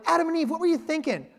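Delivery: high mock-scolding disbelief; exasperated at Adam and Eve. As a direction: scold them like they blew it. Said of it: "Have you ever had that thought?".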